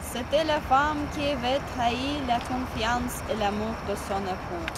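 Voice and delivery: usual speaking voice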